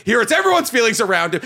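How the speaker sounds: ordinary talk, nothing marked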